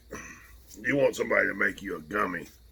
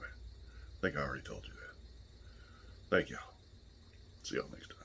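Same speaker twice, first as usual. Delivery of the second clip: breathy exhausted whisper; 7 semitones lower, 16 dB quieter than usual. Real, not acted. Fading exhausted sign-off.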